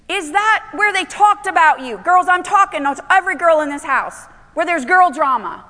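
urgent, high-pitched sermon delivery; full-force preaching. Acted. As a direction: drive each phrase home to the congregation.

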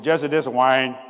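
loud, emphatic brother voice; belted-out phone greeting, imitating his brother; Wayne. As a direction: announce yourself loudly, like his brother on the phone. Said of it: "That's how he talked".

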